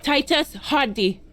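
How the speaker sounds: sharp warning call. Acted. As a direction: snap the name like a warning shot. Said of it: "Her voice rings through the room like a warning shot".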